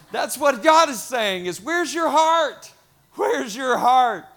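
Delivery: loud, high, ringing challenge; impassioned preaching. Real, not acted. Pastor challenging congregation on their hearts, impassioned. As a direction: drive the question home to the congregation.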